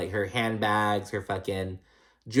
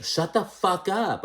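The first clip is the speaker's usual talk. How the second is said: retold shout at class; 8 semitones higher, slower than usual. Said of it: "raise your voice".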